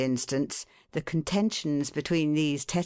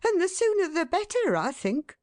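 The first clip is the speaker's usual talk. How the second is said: much higher wife's voice, a touch louder; an exasperated mother, pitched up; 3 dB louder than usual. A narrator's reading. Mrs Tetterby's voice.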